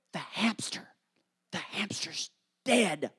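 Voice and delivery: imitating wife's secretive near-whisper; hushed bad news. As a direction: break it under your breath, slowing on the last word. Wife secretly breaks bad news.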